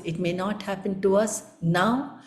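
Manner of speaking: ordinary talk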